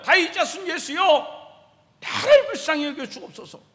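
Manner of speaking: high, loud imitated plea; acted shout for mercy. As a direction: cry out harder as the desperate beggar. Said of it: "더 크게 소리 질러요".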